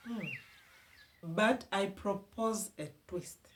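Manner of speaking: ordinary talk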